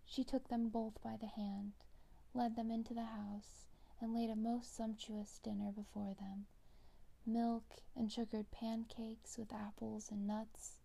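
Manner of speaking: ordinary delivery, nothing marked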